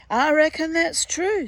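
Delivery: a swamp conjurer's smug agreement; hairy man voice. Acted.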